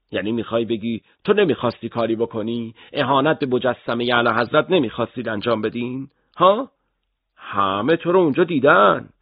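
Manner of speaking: rising to a high, loud ها and accusation; accusing shout. Acted. Interrogator cornering the arrested boy, accusing shout.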